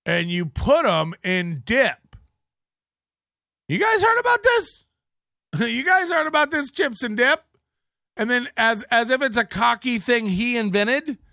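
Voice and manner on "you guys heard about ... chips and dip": high, excited mock announcement; exaggerated mock excitement, much higher. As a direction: ham up the big reveal. Mocking Chad's tweet.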